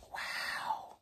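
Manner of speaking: hushed wow; whispered amazement at the count; awed whisper. Acted. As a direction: whisper it in wonder.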